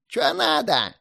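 put-on high shrill old man yell; old man shouting. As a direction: squawk it like a cranky old man. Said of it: "закричал он".

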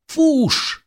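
very high, loud cry; urgent command. A narrator's reading. Brother holds off mob for twin, urgent yell. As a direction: yell one word to send them running.

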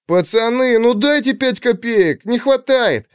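put-on high, slow childish whine; whining boy. A narrator's reading. Boy whines to friends for five kopecks, high. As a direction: plead like a ten-year-old kid. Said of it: "конючил он у друзей".

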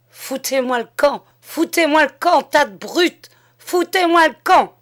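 the mayor bellows at the gawking villagers, building to a roar; furious shout. A narrator's reading. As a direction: drive the crowd off, roaring. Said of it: "devint furieux".

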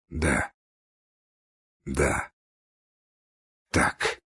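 soft, low words with long pauses; slow, spaced out. A narrator's reading. Dying man speaking slowly, with long pauses. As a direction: leave a long gap after each word. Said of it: "с расстановкой медленно проговорил умирающий".

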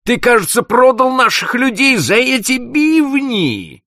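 an outraged boss accusing his clerk; furious accusation. Acted. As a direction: hurl the charge at him. Boss confronts trader, outraged.

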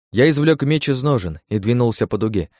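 plain reading voice

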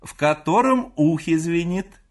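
high, loud call from the next room; крик матери. A narrator's reading. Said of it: "закричала вдруг мать из своей комнаты". (громко, высоким голосом, издалека)

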